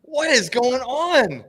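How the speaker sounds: high, slow, amazed; stunned disbelief. Real, not acted. Stunned by fight at faceoff.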